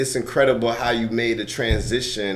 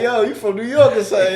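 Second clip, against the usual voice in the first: high, loud, quick burst of surprise; excited retelling; 12 semitones higher, 6 dB louder, faster than usual. Retold or imitated. Host retelling how he first met the actor, high and excited. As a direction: blurt it out, high and fast.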